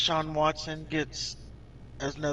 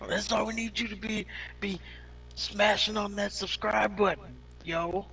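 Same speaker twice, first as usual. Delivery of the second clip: YouTuber voice; 6 semitones higher than usual. Pretend. YouTuber outro parody continued.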